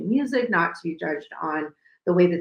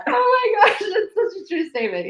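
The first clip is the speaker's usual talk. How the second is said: laughing; 13 semitones higher than usual. Real, not acted. Laughing at the joke.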